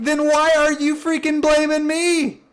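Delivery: high-pitched mock indignation; indignant protest. Acted protest at being blamed.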